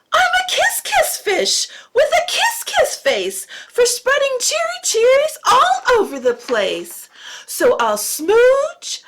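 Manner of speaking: a squealing happy fish smooching everyone; delighted kiss fish. Acted.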